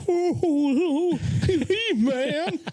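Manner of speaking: high, wailing scared cry. Retold or imitated. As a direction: shriek for He-Man in mock terror. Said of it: "he's like".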